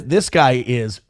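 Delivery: normal talking voice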